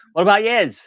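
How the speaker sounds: high, loud mock-Irish lilt; Irish accent. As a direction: call it out in an amateur Irish accent. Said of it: "had to read it in Irish accent".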